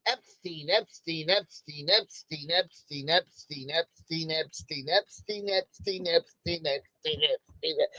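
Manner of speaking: low, quiet, evenly timed looping chant; soft, low, relentless mantra; droning repetition. Retold or imitated. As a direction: repeat the name like a stuck record, under your voice. Mimics endless repetition online.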